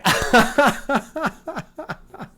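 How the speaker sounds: long high-pitched laughing fit; real rising, pulsing laughter. Real, not acted. Streamer loving a viewer's oneness joke, laughing hard.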